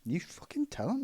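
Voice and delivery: hushed egging-on whisper. Real, not acted. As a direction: whisper it like a cheeky aside. Egging on his co-host.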